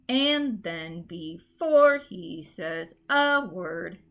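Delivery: "And then before he says a word" slow, punching AND, FORE and A; heavy, unnatural stress on every third syllable, slowly; overemphasized dactylic reading. Shown as a demonstration. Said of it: "read it in a really overemphasized way".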